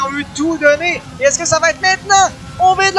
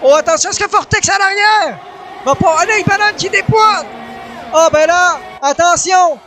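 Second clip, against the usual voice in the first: motorsport commentator; 3 semitones higher than usual. Real, not acted. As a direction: call the battle at full voice. Live play-by-play final laps. (commentateur sportif survolté)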